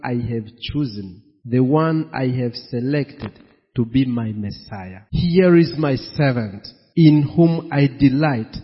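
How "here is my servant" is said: ordinary talk, nothing marked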